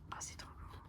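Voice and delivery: hushed, breathless whisper of wonder; awed whisper. Real, not acted. Discovering a beautiful card for viewers, awed whisper. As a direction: whisper it like a treasure you just found.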